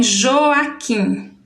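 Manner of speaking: "Joaquim" slowly as Jo-a-quim; "Joaquim" in three measured, joined beats; syllable by syllable. Shown as a demonstration. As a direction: give each syllable of "Joaquim" its own beat. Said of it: "Tem aqui o nome".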